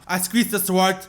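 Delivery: ordinary talk, nothing marked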